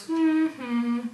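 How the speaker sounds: creepy answering hum, held out; drawn-out hummed answer. Retold or imitated. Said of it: "it's a lower frequency".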